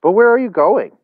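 high, loud, dismayed question; calling after her. A narrator's reading. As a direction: shout a bewildered question after someone leaving.

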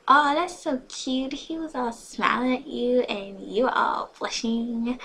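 gushing teasing. A narrator's reading. Friend teases her about blushing, gushing. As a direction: coo it loudly and draw it out.

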